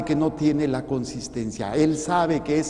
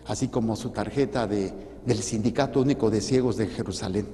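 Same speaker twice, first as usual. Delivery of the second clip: ordinary delivery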